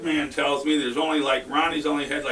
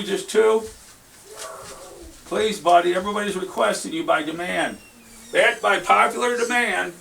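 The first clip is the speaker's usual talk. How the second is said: playful begging call; 6 semitones higher, 4 dB louder than usual. Real, not acted. Begging Jack to come pull seeds.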